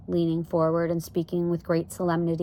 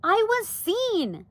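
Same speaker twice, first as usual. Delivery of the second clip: high, slow, mildly distressed protest; crying out; much slower than usual. A narrator's reading. Daughter defends herself to parents, crying out. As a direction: draw out the defensive cry. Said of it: "cried Arrietty".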